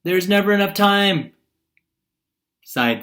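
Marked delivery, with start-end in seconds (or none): 0.0-1.3 louder, higher read-aloud; projected example sentence; raised-voice reading. Shown as a demonstration. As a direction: read the example out loud and high.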